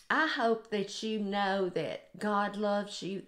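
usual speaking voice

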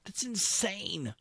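high, slow disbelief; incredulous. Real, not acted. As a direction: stretch it out in disbelief. Reacting to absurd battle result.

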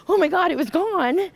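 high-pitched astonished gasp of disbelief; amazed exclamation. Retold or imitated. Alone in the room, amazed the tumor vanished, high and excited. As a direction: blurt it out in stunned joy.

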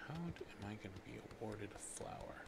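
hushed whispered question. Real, not acted. Streamer puzzling over the flower, whispering. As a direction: whisper it to yourself. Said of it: "whispered question to self".

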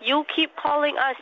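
ordinary delivery

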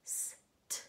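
S-t-o-m-p whispered sound by sound; whispered sound segmenting. Shown as a demonstration. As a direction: breathe out each sound of S-t-o-m-p separately. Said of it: "listening for each sound".

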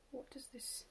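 soft unvoiced whisper; hushed, breathy question. Real, not acted. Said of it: "whispers a half question".

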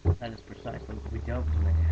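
loud, exasperated correction; irritated insistence. Acted. Mackenzie correcting Broomfondle, loud and irritated. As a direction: set him straight, loudly. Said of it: "exclaimed Mackenzie in irritation".

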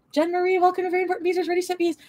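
high, bright sing-song welcome for a new member; channel greeting. Real, not acted. Streamer welcoming a new member to the channel, in a high sing-song greeting. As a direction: announce the newcomer like a cheery host.

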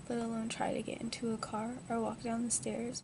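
normal talking voice

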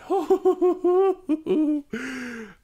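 loud high burst of laughter; relieved laugh. Real, not acted. Relief after escaping.

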